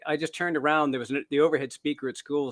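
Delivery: ordinary talk, nothing marked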